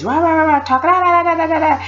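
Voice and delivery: high, rattling crowd babble; rapid mock racket on one high pitch; imitated noisy chatter. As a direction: mimic a hundred women yammering at once. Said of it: "it was always loud and noisy".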